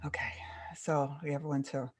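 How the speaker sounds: normal talking voice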